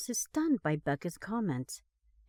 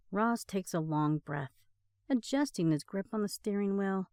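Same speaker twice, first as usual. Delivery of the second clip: usual speaking voice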